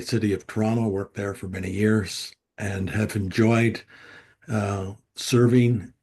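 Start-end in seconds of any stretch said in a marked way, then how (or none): none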